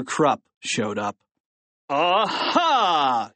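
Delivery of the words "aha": higher, triumphant "aha"; principal's shout. A narrator's reading. Principal catching the two boys, triumphant shout. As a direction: pounce on them with a drawn-out cry. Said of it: "he shouted".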